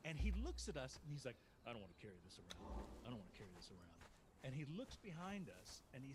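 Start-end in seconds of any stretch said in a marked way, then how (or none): 1.7-3.9 low, soft mock complaint; friend's low-pitched offhand whine; imitating reluctant friend. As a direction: grumble it quietly as the friend. Voices friend refusing to carry coins.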